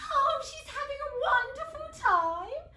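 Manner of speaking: very high, loud, fluting gush; high showy delight; delighted queen. Acted. Queen chats about her happy daughter.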